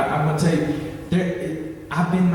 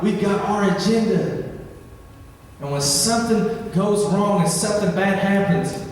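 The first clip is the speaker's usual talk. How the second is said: ordinary talk, nothing marked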